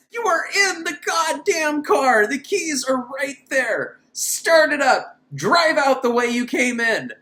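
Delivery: high, slow frustrated scolding; exasperated yelling. Real, not acted. Reviewer scolding Erica through the screen, high and exasperated. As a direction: yell at the screen in disbelief.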